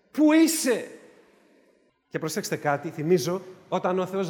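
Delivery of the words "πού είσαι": God calling out. Acted. God's call to Adam, raised.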